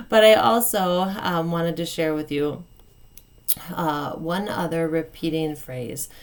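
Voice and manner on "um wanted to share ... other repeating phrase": ordinary talk, nothing marked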